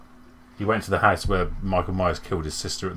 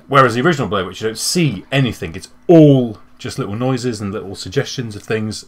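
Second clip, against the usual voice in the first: ordinary delivery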